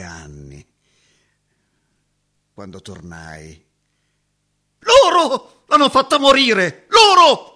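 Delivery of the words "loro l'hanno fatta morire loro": shrieking high and loud in grief; anguished shout. Acted. Accusing her parents of her death, screaming. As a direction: hurl the accusation at them. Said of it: "Gridai queste parole".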